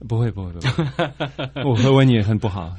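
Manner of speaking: chuckling through the words; laughing. Real, not acted. Laughing denial.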